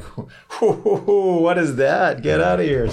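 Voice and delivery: breathy high hoots, then a scoffing brush-off; mocking Quebecois. Quebecois reacting to his French, high mock hooting. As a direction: hoot at him like a baffled Quebecer. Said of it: "And the Quebecois are like".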